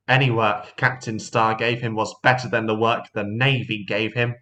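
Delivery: plain reading voice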